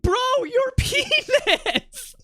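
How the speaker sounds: very high, drawn-out mock squeal; shocked squeal. Streamer retelling his reaction to a cartoon in a Speedo, shocked squeal.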